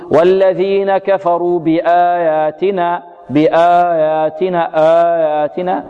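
slow chant barely touching the ياء in بآياتنا; ياء ضعيفة. Shown as a demonstration. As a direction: recite with the "y" in بآياتنا only hinted at. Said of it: "يشير الياء إشارة أو يومي". (ترتيل بطيء بإيماء خفيف إلى ياء بآياتنا)

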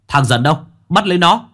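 hét vang, cao và to hơn hẳn; quát lệnh bắt người. Acted. Lính quát tìm Dần. (toán lính quan phủ xông tới quát bắt Dần)

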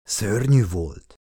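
slow, drawn-out grumble of distaste; grimacing mumble. A narrator's reading. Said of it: "motyogta Chris fintorogva".

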